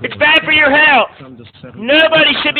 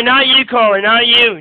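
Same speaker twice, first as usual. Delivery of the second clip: usual speaking voice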